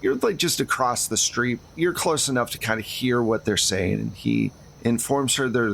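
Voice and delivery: ordinary talk, nothing marked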